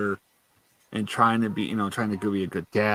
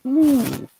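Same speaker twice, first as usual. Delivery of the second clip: crackle noise imitation; 7 dB louder than usual. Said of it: "you're, like, crackling".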